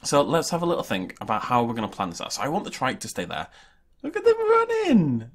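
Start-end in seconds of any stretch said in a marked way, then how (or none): 4.0-5.3 much higher, slow, delighted squeal. Real, not acted. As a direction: squeal with glee at the dinosaurs running. Delighted at running dinosaurs.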